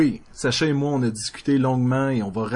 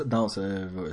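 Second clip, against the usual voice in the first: ordinary talk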